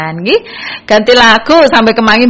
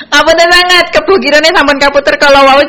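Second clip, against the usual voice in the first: high, loud "Ngapunten sangat"; eager apology; 6 semitones higher, 5 dB louder than usual. Real, not acted. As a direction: apologize in a bright, rushed burst. DJ apologizes about a missed request.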